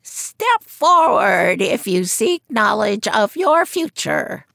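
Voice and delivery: put-on high croaky old seer's voice; croaking fortune teller. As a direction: croak it from behind a curtain. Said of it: "a voice croaked".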